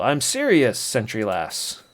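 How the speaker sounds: ordinary talk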